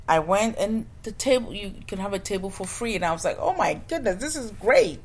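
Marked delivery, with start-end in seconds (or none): 3.4-5.0 rising higher with thrilled delight; getting giddy on the word great; excited. Retold or imitated. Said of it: "I was so excited".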